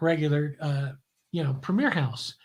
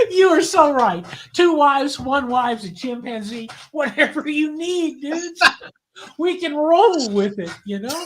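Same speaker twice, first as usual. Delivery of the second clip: put-on higher, louder laid-back good-ole-boy voice, laughing along; 8 semitones higher, 4 dB louder, slower than usual. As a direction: toss it off easy like a laughing country star. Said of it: "He goes".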